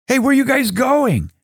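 high, startled call after leaving stones; surprised call. Acted. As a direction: call after them in disbelief. Builder calls after stones.

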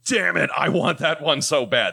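longing frustration. Retold or imitated. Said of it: "So I'm like".

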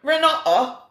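high, laughing British try at "Renata"; attempted British accent. Shown as a demonstration. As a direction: stumble into "Renata" with broad British ah vowels. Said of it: "I don't think that's right".

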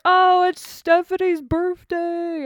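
high, slow mock crowd cheer; high singsong party crowd. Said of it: "everybody's like".

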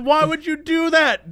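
high, drawn-out disbelieving question; mock exasperation. Teller questioning the close talker, high mock exasperation. Acts own exasperated reaction to drunk.

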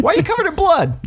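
very high, startled asking; surprised question. Pretend. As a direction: squeal it out in shock. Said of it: "acted quote in story".

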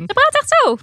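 very high squeaky imitation. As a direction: imitate a woman with a really high voice. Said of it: "hoge stem". (overdreven hoge stem)

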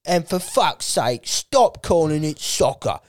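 slow, put-on know-it-all teenager voice; slow smug teen fan; mock teen fan. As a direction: lecture it slowly like a smug thirteen-year-old. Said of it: "you're like".

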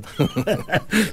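high giggle, real and light; high laugh. Real, not acted. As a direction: laugh at your own joke. Laughing at own joke.

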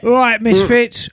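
slow, put-on Essex accent. Greeting the Misfits as Essex emailer, hammed-up Essex accent. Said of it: "Can you do that accent".

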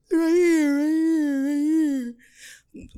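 high, loud whimpering bawl; hammed-up childlike crying; pained wailing. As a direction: sob the words out in one long wail. Acted patient wailing in pain.

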